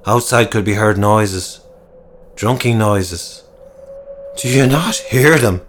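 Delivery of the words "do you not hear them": mildly raised, anxious question; a touch of paranoid dread; fearful question. Acted. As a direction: ask it as if dreading the answer. Frightened sergeant hears noises outside.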